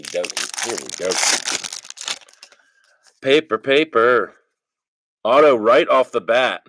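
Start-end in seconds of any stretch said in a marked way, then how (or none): 3.2-6.6 louder, thrilled card pull; calling out a big hit; excited. Real, not acted. Pulling an autograph card.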